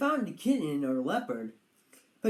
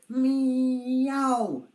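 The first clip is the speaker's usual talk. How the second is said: kitten meow; 7 semitones higher, 6 dB louder than usual. Acted. Kitten cries in the dark.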